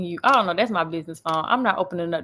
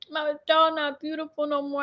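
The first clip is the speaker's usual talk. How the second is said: higher-pitched, mock-sad little girl; imitated crying child; 7 semitones higher than usual. Daughter upset over her undressed doll, imitated crying child. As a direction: mimic an upset small daughter. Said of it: "and then she was crying".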